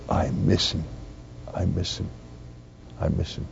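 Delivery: soft, fading, with a touch of a tearful break; retold crying. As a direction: quietly echo the old man's broken grief. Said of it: "And then he broke down crying".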